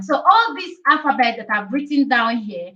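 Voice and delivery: ordinary talk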